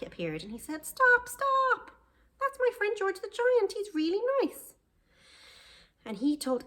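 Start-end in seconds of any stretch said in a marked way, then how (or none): 0.8-4.7 put-on very high, loud childlike plea; Jack pleading loudly. Jack begging villagers to stop chopping, high. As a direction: cry out to save his friend.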